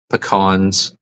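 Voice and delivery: pecans said puh-KAHNS; pecans as puh-KAHNZ; puh-KAHNS pronunciation. Shown as a demonstration. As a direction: say pecans with short first vowel, open ah. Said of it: "however it is".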